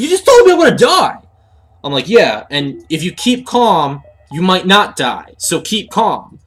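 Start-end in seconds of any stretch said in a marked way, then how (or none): 0.0-1.2 high, loud panicked shriek as Craig; panicked yell. Retold or imitated. Craig yells at his coworker in high panic. 2.1-6.4 slow, drawn-out calming voice; mock calm. Coworker slowly tells the panicking Craig to keep calm.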